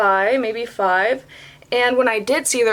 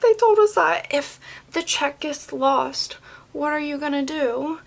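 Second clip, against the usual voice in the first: high-pitched mimicking of her; mimicking someone; 7 semitones higher than usual. Retelling what she said about the checks, mimicking high. Quoting the complaining customer or coworker.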